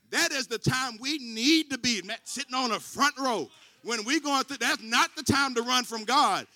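loud, high preaching climax; shouting sermon, slow on the peaks; full-force preaching. Acted. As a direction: drive it home to the whole church.

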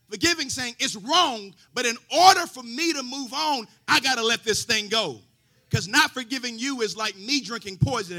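loud, sung-out preaching build; full-force preaching. Acted. Preacher calling the church to forgive, at full force.

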